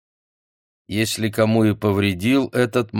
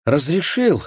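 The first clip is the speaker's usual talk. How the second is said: eager, hopeful high question; hopeful exclamation; 7 semitones higher than usual. Acted. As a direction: ask with sudden hope. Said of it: "с надеждой воскликнула".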